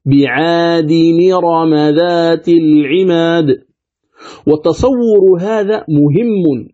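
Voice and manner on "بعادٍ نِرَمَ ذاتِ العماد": "بعاد نرم ذات العماد" slow and level, all linked; measured recitation, hamza replaced by ni; linked recitation demo. Shown as a demonstration. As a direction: recite bi-'aadi-ni-rama with stretched vowels. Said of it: "فصارت".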